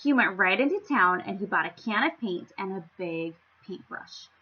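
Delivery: usual speaking voice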